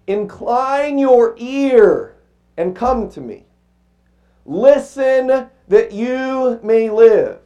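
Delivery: slow, weighty reading of scripture, each line held; slow solemn proclamation from the pulpit. Acted. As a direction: read it like a preacher proclaiming.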